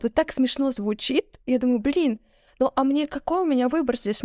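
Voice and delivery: ordinary delivery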